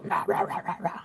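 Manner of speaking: soft voiceless rah rah, fading out; a whispered hippo roar as a greeting; breathy rah rah for the hippo card. Shown as a demonstration. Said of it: "our gesture for the hippo will be greeting".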